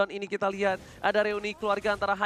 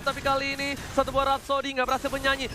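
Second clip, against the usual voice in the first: rising fast, loud esports call; excited play-by-play; 6 semitones higher, 7 dB louder than usual. Real, not acted. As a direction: call the teamfight with mounting hype. (komentator heboh)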